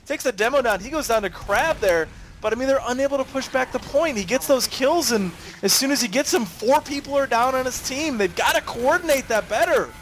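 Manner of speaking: high energetic play-by-play; excited commentary. Real, not acted. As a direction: push the analysis hard. Heated live analysis.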